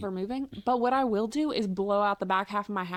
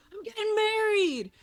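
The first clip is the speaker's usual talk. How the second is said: mock announcement; 10 semitones higher than usual. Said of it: "Nick walk in the room and say".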